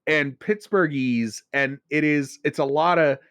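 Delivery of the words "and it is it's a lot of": ordinary delivery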